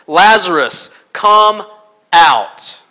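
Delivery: loud, slow commanding call; big, booming summons with pauses; loud cry. A narrator's reading. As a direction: call the dead out, word by word. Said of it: "he cried out in a loud voice".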